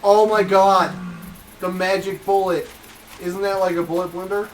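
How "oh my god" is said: excited. Real, not acted. Sudden realization about Magic Bullet.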